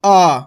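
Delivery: आ stretched into a long 'aa'. Shown as a demonstration. Teacher contrasting the long vowel for students, stretched. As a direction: hold आ as a long open vowel. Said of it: "इसका तो होएगा आ और इसको होएगा".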